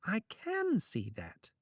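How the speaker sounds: high, slow, smug smile in the voice; smiling. A narrator's reading. Trickster enjoys watching Thor stew, smugly smiling.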